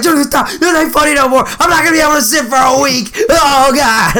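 high-pitched imitation of a begging victim; comic distressed wail; mock pleading. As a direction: beg them to stop, hammed up. Imitates brother begging while being punched.

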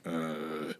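soft, low muttered reaction; stunned onlookers. Retold or imitated. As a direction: imitate dumbstruck bystanders under your breath. Acts out stunned bartenders standing by.

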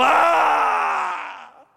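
acting out a drug addict's cry; imitated addict's scream. Pastor imitating a screaming addict, loud. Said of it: "encontrarlo por allá gritando".

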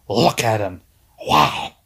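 Vince voice. Retold or imitated. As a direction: gush like an awestruck promoter. Vince admiring a big wrestler.

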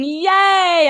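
high, loud mock cheer; sarcastic yay. Real, not acted. Announcing her period is coming, loud mock cheer. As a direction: cheer with heavy irony.

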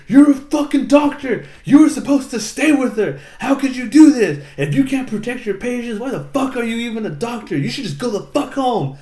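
high, loud anime tirade; imitated furious screaming. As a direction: scream it like an all-in voice actor. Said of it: "screams at Rain".

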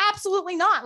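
high-pitched retold refusal; indignant imitation of teen self; adamant refusal. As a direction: refuse outright, pitch jumping up. Teen flatly refuses mom.

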